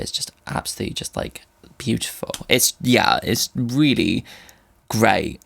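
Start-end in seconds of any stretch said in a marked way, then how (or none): 0.0-2.3 hushed awe. Real, not acted. Moved by the music.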